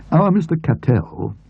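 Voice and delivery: usual speaking voice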